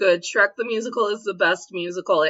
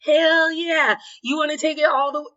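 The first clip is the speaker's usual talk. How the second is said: higher-pitched, playful "hell yeah" come-on; retold eager egging-on, pitched up; mock-excited cheer; 7 semitones higher than usual. Dancer playing along with the friend holding her, mock-excited.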